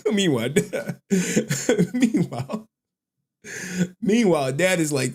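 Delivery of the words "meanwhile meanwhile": normal talking voice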